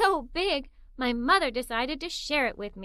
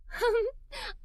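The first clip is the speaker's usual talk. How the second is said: a quick bright chuckle, higher than usual; one short amused laugh; 10 semitones higher, 3 dB quieter than usual. A narrator's reading. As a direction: laugh at the sight of mom in coach gear. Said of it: "I laughed when I saw my mother".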